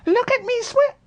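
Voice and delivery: very high squeaky voice; a proud little joey squeaking; squeaked. A narrator's reading. Little Roo shows off in the water, very high squeak. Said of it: "squeaked Roo".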